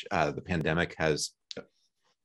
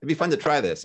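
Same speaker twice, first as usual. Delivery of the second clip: much higher, eager, excited questions; higher, eager beginner pestering the fishermen; eager newbie; 9 semitones higher than usual. Retold or imitated. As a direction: bounce like an excited novice on the boat. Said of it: "I would be the guy".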